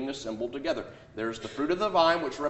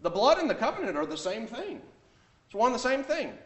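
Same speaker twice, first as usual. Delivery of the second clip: mocking quote; 10 semitones higher than usual. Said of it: "he said".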